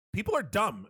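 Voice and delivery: ordinary talk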